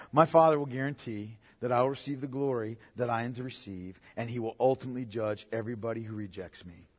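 low, hushed preacher's delivery; solemn assurance. Acted. Jesus assuring listeners of the Father's judgment, solemn and hushed. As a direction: drop right down, sinking to near silence.

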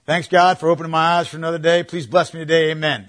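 rattled-off mock prayer, fast and loud; quick shotgun prayer. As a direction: race through a perfunctory prayer to get it over with. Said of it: "just a shotgun prayer into heaven".

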